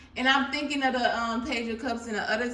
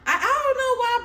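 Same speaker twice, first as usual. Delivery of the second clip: excited burst; 12 semitones higher, 6 dB louder, faster than usual. Real, not acted. High burst.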